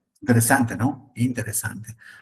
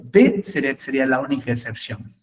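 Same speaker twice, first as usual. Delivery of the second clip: normal talking voice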